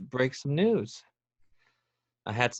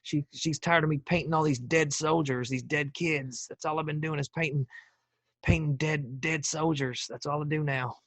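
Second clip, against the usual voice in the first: put-on presidential impression, higher pitch; George W. Bush; 4 semitones higher than usual. Ex-president confessing his painting habit to listeners, Bush impression. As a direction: impersonate Bush rambling haltingly. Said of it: "as Bush".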